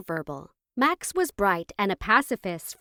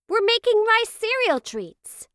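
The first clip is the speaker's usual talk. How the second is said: put-on high, loud excited kids' voices; excited exclamation; 14 semitones higher, 5 dB louder than usual. A narrator's reading. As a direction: squeal it like thrilled children. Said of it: "they exclaimed excitedly".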